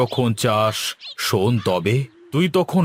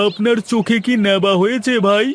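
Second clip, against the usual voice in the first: higher and louder, mocking disbelief; sarcastic jab; 9 semitones higher, 4 dB louder than usual. Grocer mocks the servant asking for a wooden doll. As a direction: needle him for his foolishness.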